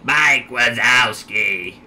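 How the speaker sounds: slow, drawn-out monster voice; Roz. Pretend. Gamer imitating the Monsters, Inc. character, slow character voice. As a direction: drag the name out in character. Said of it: "turning to Roz from frickin' Monsters".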